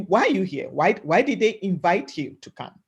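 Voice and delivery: ordinary talk, nothing marked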